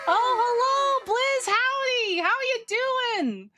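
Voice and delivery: high, slow, bubbly hello to chat; greeting a viewer in a high, cutesy voice; excited greeting. Real, not acted. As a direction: sing out the welcome, way up high. Greeting chat members cutely.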